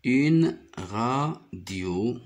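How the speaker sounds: "Une radio" very slowly as une... ra... dio; radio split into held beats; syllable by syllable. Shown as a demonstration. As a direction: sound out radio for beginning readers. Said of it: "J'y découpe les mots comme ça".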